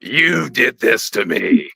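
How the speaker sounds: slow, drawn-out mock demon; demonic voice. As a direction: imitate a possessed woman in labor, slowly. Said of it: "In a demonic voice".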